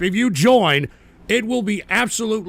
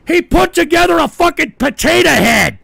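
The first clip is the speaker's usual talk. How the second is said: loud, higher furious rant; angry rant; 6 semitones higher, 5 dB louder than usual. Real, not acted. Ranting at chat about a potato head, loud and furious. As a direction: yell it in disbelief.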